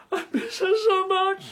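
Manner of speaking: tearful voice breaking into sobs; crying. Acted. Old man weeping for his dead wife, voice cracking. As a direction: cry through the words, missing his late wife. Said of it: "Stop crying".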